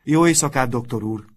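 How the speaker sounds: loud, high good-night call. A narrator's reading. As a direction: call it out like a whole dormitory. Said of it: "kiáltották valamennyien".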